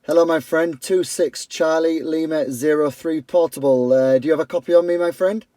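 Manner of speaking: usual speaking voice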